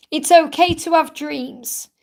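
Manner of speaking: ordinary talk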